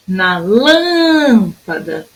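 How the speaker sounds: "lâmpada" called out with a very long lâm; chamando, LÂM esticado. Shown as a demonstration. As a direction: stretch the first syllable of "lâmpada" in a calling tune. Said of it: "vamos chamar a palavrinha". ("lâmpada" chamada com o lâm bem comprido)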